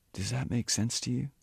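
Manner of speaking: ordinary delivery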